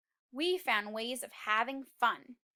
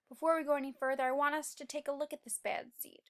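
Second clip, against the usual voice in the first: usual speaking voice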